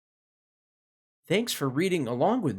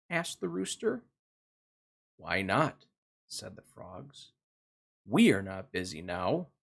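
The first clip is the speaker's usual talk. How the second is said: put-on gentle storybook narration for kids; reading to children; 2 semitones lower, slower than usual. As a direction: read it like a bedtime picture book. Reads picture book to children.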